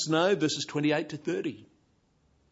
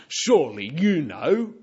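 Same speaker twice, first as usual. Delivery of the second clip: mocking sarcasm; 6 semitones higher, 9 dB louder than usual. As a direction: needle him with a mock-impressed rise. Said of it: "sense the rebuke and sarcasm in verse twenty-one".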